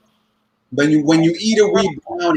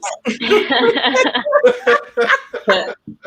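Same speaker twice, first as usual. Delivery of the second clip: high, bursting laugh at his own joke; big laugh; 9 semitones higher than usual. Real, not acted. Comic laughing at his own weed joke, high burst of laughter. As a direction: cracks up hard, pitched way up.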